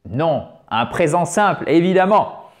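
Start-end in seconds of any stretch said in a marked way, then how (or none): none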